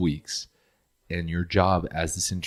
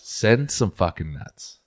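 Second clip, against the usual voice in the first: slow emphatic demand; 4 dB louder, much slower than usual. Real, not acted. Joking demand.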